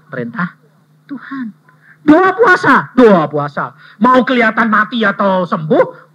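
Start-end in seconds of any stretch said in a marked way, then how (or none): 2.1-6.1 loud, high preaching call; preaching emphasis. Real, not acted. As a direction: proclaim it to the hall. (seruan khotbah keras)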